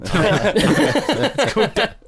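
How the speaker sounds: laughing, drawn out slowly; giggling through the words. Acted. Slow laughing repeat of joke.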